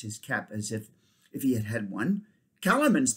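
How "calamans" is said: loud angry outburst; king roars at the rat; thundered. Acted. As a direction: thunder the word in outrage. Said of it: "thundered Tyrion".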